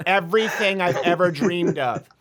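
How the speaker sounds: hammed-up pleading, ending slow and loud; a hint of an over-earnest young admirer; lightly imitated smitten girl. Acts out girl's intense love declaration.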